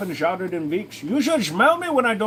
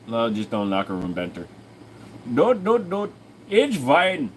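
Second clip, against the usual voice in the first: mock Indian accent; 3 semitones higher than usual. Streamer teasing chat in a mild mock Indian accent. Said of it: "me doing this accent".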